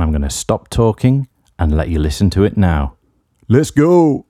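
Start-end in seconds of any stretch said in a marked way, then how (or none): 3.5-4.2 big high radio DJ shout; hyped DJ voice. Real, not acted. Radio host hyping the track to listeners, high excited DJ call. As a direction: pump up the crowd like a local radio DJ. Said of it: "regretting my decision to say "let's go" in that voice".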